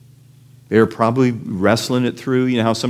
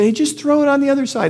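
high, carrying holler to a boat; calling from shore; 4 dB louder, faster than usual. Acted. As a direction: shout instructions across the water. Jesus calling to the fishing boat.

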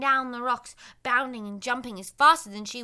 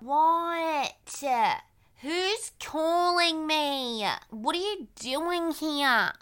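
put-on pumpkin boy voice; 4 semitones higher, much slower than usual.

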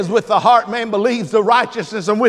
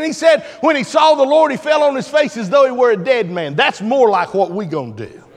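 usual speaking voice

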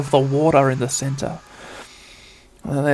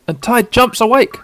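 plain reading voice

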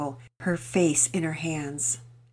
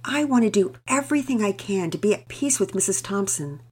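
a touch higher, sincere pleading mother; lightly voiced earnest mother; 5 semitones higher, 5 dB louder than usual. Mother to husband, lightly voiced and earnest. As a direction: gently voice a devout mother explaining herself. Mama Peters voiced by narrator.

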